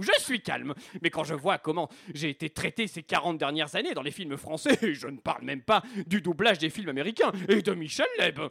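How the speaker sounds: worked-up, put-on African accent. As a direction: insist and protest in an African accent. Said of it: "Je m'adresse maintenant à accent africain". (accent africain, remonté)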